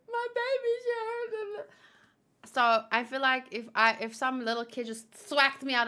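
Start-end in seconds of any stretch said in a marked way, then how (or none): 0.0-1.5 high, gasping sob-voice for a hurt pet; mock crying. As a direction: wail over the baby like a fussy mom. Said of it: "every time I cried".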